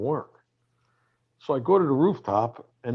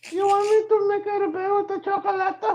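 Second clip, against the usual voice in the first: mock housekeeper voice. Comic teasing the rock star as a falsetto housekeeper. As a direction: ham up a high-pitched hotel maid. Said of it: "I'll be like this".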